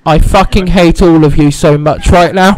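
very loud, slow, low roar; exasperated outburst. Real, not acted. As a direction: blast the whole group at full volume. Teased, blasts his friends.

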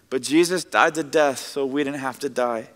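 ordinary delivery

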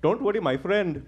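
put-on a light Indian accent as the old-school dad; dad's advice in a mild Indian accent. Old-school dad advising his son, in a light Indian accent. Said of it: "the guy playing my dad in the story is played by an Indian".

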